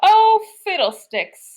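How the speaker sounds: put-on old man's slow, disdainful grumble; sniffing scornfully. A narrator's reading. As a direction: dismiss the snow with a slow huff. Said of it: "sniffed Grandpa Dan".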